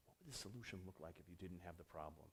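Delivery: very soft and low, musing; hushed pondering. Retold or imitated. Developer mulling over an older engineer's question, hushed and low. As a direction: turn it over quietly, almost to yourself.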